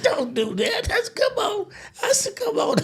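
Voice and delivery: high, laughing plea, near crying. Retold or imitated. Begging friend to let him ride, laughing plea. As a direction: beg him while cracking up. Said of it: "I couldn't help him laughing".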